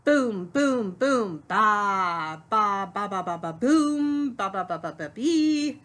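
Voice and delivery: loud, booming oompah notes; imitated tuba playing. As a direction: boom it out like a tuba, jumping up on accented notes. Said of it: "take out my tuba and begin to play".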